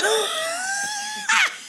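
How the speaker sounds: high, strained laugh breaking into crying; laugh turning to sobs. Real, not acted. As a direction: laugh through tears. Laughing while near tears.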